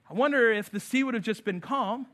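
normal talking voice

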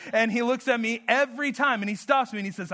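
usual speaking voice

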